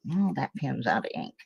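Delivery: usual speaking voice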